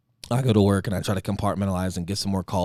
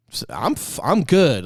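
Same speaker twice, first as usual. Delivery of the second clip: stammered then pushed out "good"; forced fine; 5 semitones higher, 4 dB louder than usual. Retold or imitated. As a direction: fake it's-fine cheer. Forcing he's fine after death.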